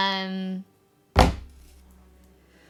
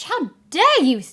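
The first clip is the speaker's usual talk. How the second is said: mock outrage; 8 semitones higher, 9 dB louder than usual.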